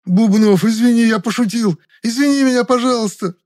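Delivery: high, loud, desperate plea; begging apology. A narrator's reading. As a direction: beg for release. Bully begs while pinned.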